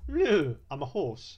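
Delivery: slow, loud mock horse; hammy slow horse voice; horse impression. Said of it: "that was my horse impression".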